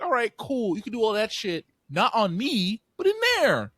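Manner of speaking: high, animated telling-off; mock firm parent. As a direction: lay down the law to a baby, playfully. Acts out ordering baby into playpen.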